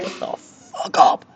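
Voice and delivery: loud, exasperated yell; angry yell. Real, not acted. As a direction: yell back at the speaker. Yelling at the loud announcement.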